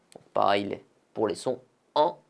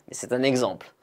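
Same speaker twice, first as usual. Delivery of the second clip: ordinary delivery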